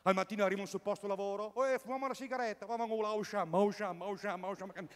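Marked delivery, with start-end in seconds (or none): 1.6-4.9 mock Neapolitan workers. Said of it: "sono tutti napoletani".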